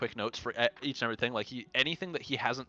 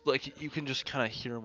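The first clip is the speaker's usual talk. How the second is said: usual speaking voice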